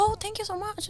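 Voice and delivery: high, very soft gushing thanks; polite phone thanks. Retold or imitated. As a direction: thank the caller in a small high voice. Polite thanks to generous caller.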